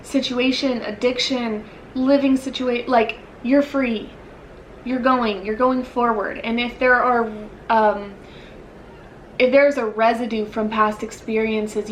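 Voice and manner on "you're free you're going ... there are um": usual speaking voice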